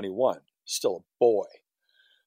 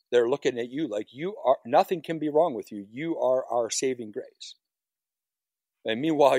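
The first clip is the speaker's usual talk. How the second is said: normal talking voice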